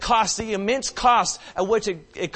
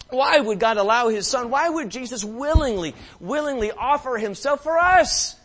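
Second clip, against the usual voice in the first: higher and urgent, pressing each why; full-force preaching; 6 semitones higher, 5 dB louder than usual. Real, not acted. Preacher pressing the congregation on why God gave His Son, urgent. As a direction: drive the question home.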